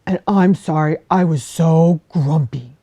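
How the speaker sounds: loud, gruff big-bear voice; a big bear sending the fox away, loud and grumpy. Acted. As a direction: shoo her off like a cross old bear. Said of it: "said Jefferson Bear".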